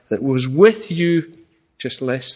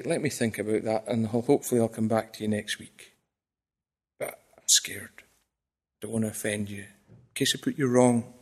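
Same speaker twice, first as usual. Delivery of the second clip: much softer and lower, nearly whispering; timid hushed reply; 6 semitones lower, 7 dB quieter than usual. Retold or imitated. Preacher mocks his own timid answer to critics, much softer and lower. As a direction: shrink back and murmur like a scared preacher. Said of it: "with as much grace I can muster".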